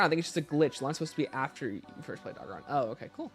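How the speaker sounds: ordinary delivery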